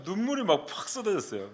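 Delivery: ordinary talk, nothing marked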